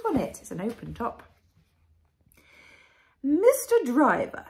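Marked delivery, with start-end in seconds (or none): 3.2-4.5 high, loud regal call; grand queen. A narrator's reading. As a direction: summon him like royalty. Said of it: "she said".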